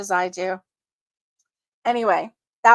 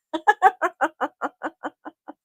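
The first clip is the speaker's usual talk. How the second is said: giggle; 5 semitones higher than usual. Real, not acted. Laugh after quote.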